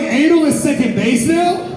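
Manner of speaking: incredulous shout. Retold or imitated. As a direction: yell it like the rules changed without you. Retold shocked reply to date.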